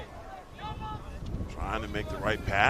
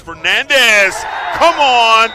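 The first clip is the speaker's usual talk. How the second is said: loud, high goal shout; 12 semitones higher, 14 dB louder, slower than usual. Real, not acted. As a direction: yell the goal call. Announcer calls a goal.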